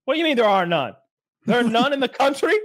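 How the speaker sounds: mock-outraged newsman who can't believe the map; incredulous disbelief. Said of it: "like that tone".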